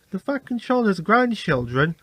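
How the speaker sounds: plain reading voice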